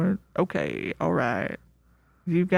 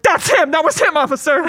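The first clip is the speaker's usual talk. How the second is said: excited witness; 8 semitones higher, 5 dB louder than usual. Retold or imitated. Witness identifying suspect excitedly.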